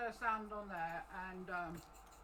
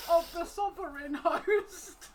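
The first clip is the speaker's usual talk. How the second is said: "sovereign" fixed through laughter, higher and louder, slow; laughing correction; 7 semitones higher, 10 dB louder, slower than usual. Real, not acted. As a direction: correct yourself while cracking up.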